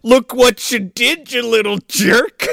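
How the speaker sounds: high, slow, drawn-out mock scolding; hammed-up scolding. Father scolding the kid, exaggerated slow scolding. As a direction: stretch each word into a hammy telling-off.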